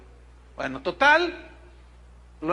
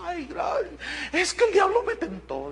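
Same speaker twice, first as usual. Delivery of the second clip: high, put-on woman's voice, lightly tearful; mock weepy high-voiced thanks; imitating her tearful plea; 10 semitones higher than usual. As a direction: mimic the repentant wife thanking him, a touch teary. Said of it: "Y ella lloraba".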